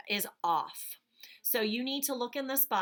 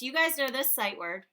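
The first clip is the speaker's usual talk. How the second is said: usual speaking voice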